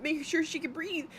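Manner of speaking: a hammed-up worried plea; mock panic. Mother retelling her fear at the pool, mock panic. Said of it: "I'm, like, fucking panicking".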